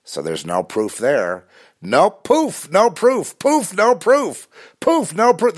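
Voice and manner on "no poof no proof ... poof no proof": very high, loud sing-out; hammed-up high-pitched repetition; theatrical vanishing act. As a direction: chant it high and loud, like a magician making things vanish. Acting out evidence vanishing.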